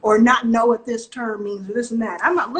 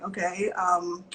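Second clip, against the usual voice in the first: slow, doubtful investor question; a touch of sceptical investor; skeptical reply; 4 semitones lower, slower than usual. Retold or imitated. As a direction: drag it out, unimpressed. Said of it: "And I'm like".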